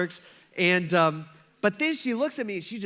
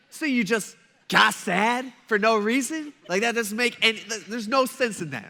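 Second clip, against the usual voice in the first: mock incredulous; 3 semitones higher, 3 dB louder than usual. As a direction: act baffled by pointless tears, hammed up. Acting out his bafflement at coworker.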